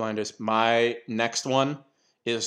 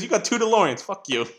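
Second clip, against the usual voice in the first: mock outrage; 10 semitones higher, 4 dB louder than usual. Patton Oswalt mocking Jerry.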